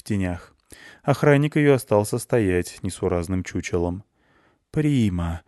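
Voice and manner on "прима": usual speaking voice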